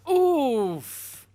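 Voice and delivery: high, loud pained oof; loud shocked oof. Real, not acted. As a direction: wince out loud at the score. Reacting to the five percent.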